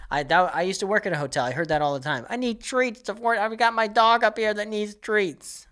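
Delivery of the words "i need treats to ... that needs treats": mock the entitled dog owner asking front desk; whiny mock guest request; needy guest. Mocking needy hotel guest.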